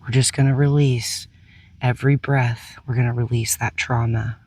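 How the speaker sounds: calm, unhurried meditation guide with long pauses; soothing guide through breath and release; guided meditation. Real, not acted.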